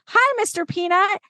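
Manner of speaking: put-on high, small child's greeting; high little voice of a child at a parade; little kid voice. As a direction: chirp it like a shy little kid. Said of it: "I hear this little voice go".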